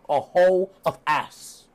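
slow, theatrical insult; Matt Hardy. Retold or imitated. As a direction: pronounce each word with mock grandeur. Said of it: "Matt Hardy is like".

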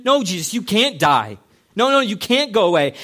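loud insistent pleading denial; protesting shout. Retold or imitated. Peter arguing, retold quote.

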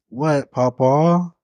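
slow, drawn-out answer; groggy reply. Retold or imitated. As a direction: answer like just woken up. Roused from sleep, answering.

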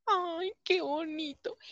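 touched sigh. Retold or imitated. Her moved reaction, quoted.

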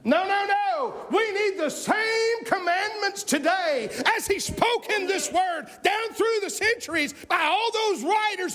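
high, driving pulpit cry; fervent preacher in full flow; full-force preaching. Real, not acted. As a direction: drive each phrase home at a high pitch.

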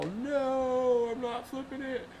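a hint of a stubborn old man, pitched high; lightly voiced refusal. Old boater refusing the guide's advice, lightly mimicked.

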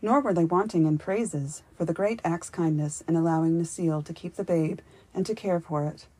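ordinary delivery

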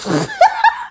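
high, sudden real laugh; burst of laughter. Real, not acted. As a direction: crack up mid-sentence. Laughs at own joke.

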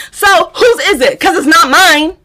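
high, insistent questioning; indignant demand. Retold or imitated. Retold confrontation.

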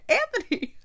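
high-pitched mock shock; shocked exclamation. Retelling Kate's alarm at Anthony, high mock shock. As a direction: squeak the name in protest.

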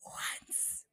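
breathy whispered single word. Real, not acted. To listeners about her concert dream, whispering once. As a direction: let the word fall to a whisper. Longing to see [name].

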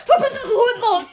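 fast, high, very loud laughing shout; loud yell. Acted. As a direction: bellow the insult through laughter. Repeats the punchline louder.

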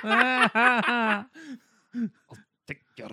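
a hint of Scottish accent. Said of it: "oh, that's a bit Scottish".

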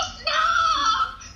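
high, loud laughing cry of no; mock anguished no; despairing shout. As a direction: wail it at home. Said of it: "fue como".

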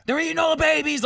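high-pitched mock conspiracy ranting; imitated frenzied rant. Woman ranting while driving there, mock frenzied high pitch. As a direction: rant like a revved-up true believer. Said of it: "it was that level of energy".

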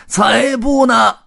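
high, drawn-out angry shout; loud, slow yell of contradiction; roaring objection. A narrator's reading. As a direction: bellow a flat refusal in a tramp's voice. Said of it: "红胡子吼道".

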